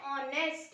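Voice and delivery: ऑनेस्ट, शुरू की एच चुप, ओ से आरंभ; silent h. Shown as a demonstration. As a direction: say ऑनेस्ट starting on the vowel o. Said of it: "इसको हम कैसे प्रोनाउंसिएशन करते हैं". (एच बोले बिना ऑनेस्ट)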